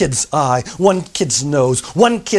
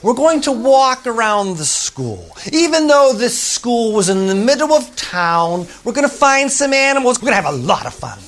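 high, bright teacher announcement; rally the class for a fun walk; cheery teacher; 7 semitones higher than usual. Acted. Teacher to her class, cheery and high.

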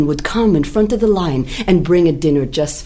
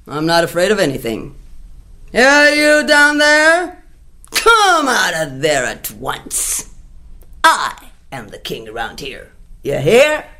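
lion's challenge. Acted. Lion shouts at his reflection in the well. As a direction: roar a challenge.

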